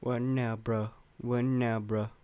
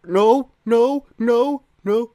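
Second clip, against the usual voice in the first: high, loud cries of alarm; panicked no's; 12 semitones higher, 9 dB louder, slower than usual. Real, not acted. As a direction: cry out in real gamer panic. Near death in game.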